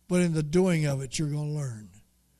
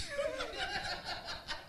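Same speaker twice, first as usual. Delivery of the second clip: high burst of laughter; 15 dB quieter than usual. Real, not acted. Laughs at God's trick.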